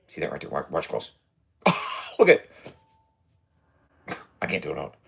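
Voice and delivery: normal talking voice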